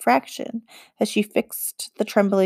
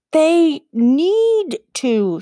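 high, very slow insistent "they need to"; pressing; 8 semitones higher, 4 dB louder, much slower than usual. Acted. Girl pressing the witch to let the boys pass. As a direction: lean on every word. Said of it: "she pressed".

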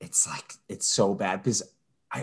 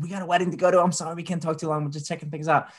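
a bit higher, hurried excuse; mild imitation of wife's excuse; lightly voiced wife; 7 semitones higher, faster than usual. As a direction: brush her off quickly. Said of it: "Mallory was like".

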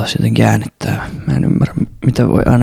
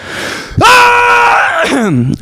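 sudden shout. Real, not acted. Shouting into the mic as a nod to Ibe, high long yell. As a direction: let out one long full-throated scream. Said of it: "Anteeksi, toi oli Ibelle".

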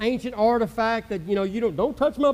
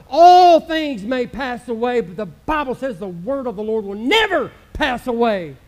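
louder, higher, rolling preacher cadence; full-force preaching; 4 semitones higher, 6 dB louder than usual. Acted. Pastor preaching to the church about the power of the Word, fervently. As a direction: preach it with fire.

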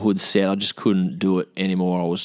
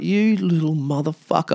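retold outrage, pitched up without shouting; angry; 8 semitones higher than usual. Injured man answers his laughing houseguest, angry. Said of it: "I was so angry".

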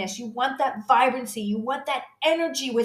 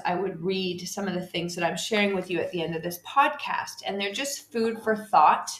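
ordinary talk

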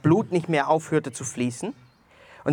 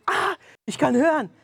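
joyful exclamation; 11 semitones higher than usual. Retold or imitated. Woman repeats joyful news.

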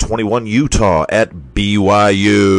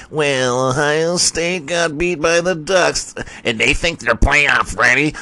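mocking announcer voice; 5 semitones higher than usual.

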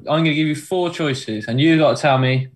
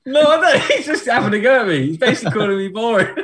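high-pitched laughing indignation; squeaky, amused complaint; laughing protest; 9 semitones higher, faster than usual. Real, not acted. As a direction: protest quickly through giggles. Teased host protests while laughing.

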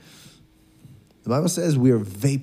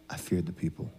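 soft, low acted confession; hushed, retold confession; quiet admission; 5 semitones lower, 12 dB quieter than usual. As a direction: drop the voice to own up. Repeats Saul's confession.

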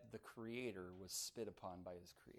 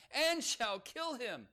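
each "and" punched, higher and louder, climbing; "and" hit hard at each clause start, rising in pitch; stress on and; 12 semitones higher, 7 dB louder, slower than usual. Shown as a demonstration. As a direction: hammer every "and" as it builds. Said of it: "read it again with emphasis on those".

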